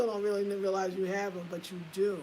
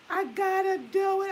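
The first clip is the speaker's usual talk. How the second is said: determined cry; 12 semitones higher, 8 dB louder than usual. Retold or imitated. Retelling resolve.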